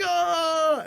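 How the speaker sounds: high, held "ah"; retold emotional cry. Reenacting grabbing the family in a hug, high emotional cry. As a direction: let out a long high wail of emotion.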